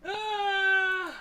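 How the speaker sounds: sudden surprised cry; short startled shout; excited yelp. Real, not acted. Sudden reaction at screen.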